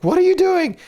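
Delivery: high, loud retold yell at a kid; mock the stressed parent snapping, high and loud; exasperated snap at child. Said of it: "I was just like".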